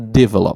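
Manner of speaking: "develop" stressed on the first syllable, DE-velop. Shown as a demonstration. Recalling an old mistake, "develop" stressed on first syllable. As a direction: put the weight on the "de" of "develop". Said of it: "a stress in the first syllable".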